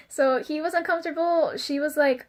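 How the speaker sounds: ordinary talk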